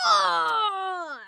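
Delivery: loud, drawn-out mock roar; playful imitation of a roaring dragon. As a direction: roar like a dragon in a child's retelling. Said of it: "And then the dragon roared".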